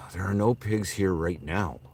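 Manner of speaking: mock-solemn slowed-down reassurance; slow emphatic repeat. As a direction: lean on "no" and stretch "There are no pigs here right now". Repeating joke about the police, slower.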